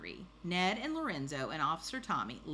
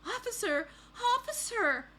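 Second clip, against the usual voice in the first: put-on high-pitched urgent child's shout; storybook cry for help; 5 dB louder than usual. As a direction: call out for the officer, high and loud. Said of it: "shouted Baby".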